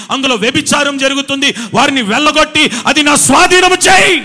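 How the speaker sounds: prayer building from plain to loud, high shout; fervent prayer. Retold or imitated. Pastor retelling his prayer at the gate, rising to a shout. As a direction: pray harder and harder until shouting. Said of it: "gate దగ్గర నిలబడి ప్రార్థన".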